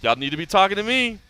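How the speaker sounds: high, loud swaggering trash talk; cocky fighter boast. Retold or imitated. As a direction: brag loudly like a cocky fighter. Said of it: "he was like".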